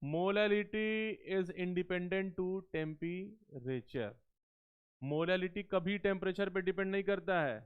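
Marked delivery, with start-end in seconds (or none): 0.0-4.1 every word spaced out for note-taking; slow dictation. Shown as a demonstration. Teacher dictating a rule to the class, very slow. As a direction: dictate it slowly so they can write. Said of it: "तो लिख लो".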